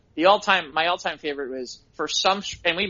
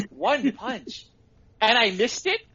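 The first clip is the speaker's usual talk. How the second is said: mock disbelief; 6 semitones higher, slower than usual.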